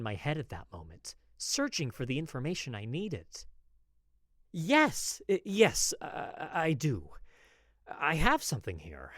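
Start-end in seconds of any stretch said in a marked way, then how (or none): 4.5-9.1 loud, high, tripping over words; flustered stammer. Acted. Apothecary rushing to answer the lady, flustered stammer. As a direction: stammer yes eagerly then slow down.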